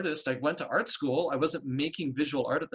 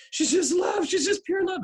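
much higher, loud tearful cry of wonder; 7 dB louder than usual. Retold or imitated. Telling her teacher through tears that the goddess is pure love, high loud crying. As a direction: sob it out to the teacher, overwhelmed. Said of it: "and I was crying".

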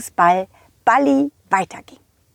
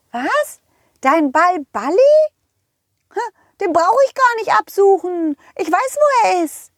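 squeaky high cartoon horse calling out; 6 semitones higher than usual. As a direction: play a cheerful high-pitched pony. Rosa the horse speaks. (hohe quietschige Pferdestimme)

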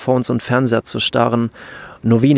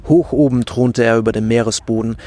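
ordinary delivery, nothing marked

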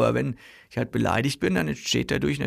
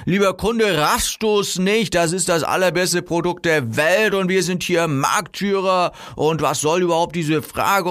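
higher, hammed-up salesman bluster; wildly exaggerated pitch; 4 semitones higher than usual. Seller lecturing a doubting customer, wildly overblown. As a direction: oversell it, impatient with any doubt. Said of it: "also wahnsinnig übertreibe".

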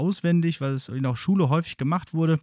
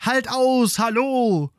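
cheering; 11 semitones higher, 4 dB louder, slower than usual. Acted. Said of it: "das Schiffsvolk jubelt".

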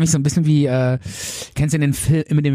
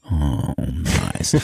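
low, drawn-out contented sigh; blissed-out sigh; 12 semitones lower than usual. Pretend. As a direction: melt into mock serenity. Gong rung again.

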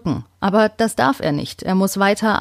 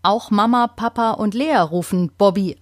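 plain reading voice

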